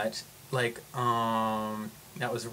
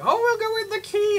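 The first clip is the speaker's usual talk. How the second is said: much higher, airy mock voice of the car owner; 5 dB louder than usual. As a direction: breezily mimic the clueless owner. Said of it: "they're just like".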